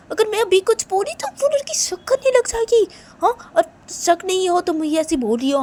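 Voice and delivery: high, agitated complaint; distressed friend. Acted. Friend at a loss how to get the address, high and agitated. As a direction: protest helplessly in a high, strained voice.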